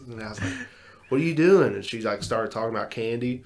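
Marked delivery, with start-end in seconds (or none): 1.1-1.7 startled yell. Retold or imitated. Startled by sleepwalker in dark.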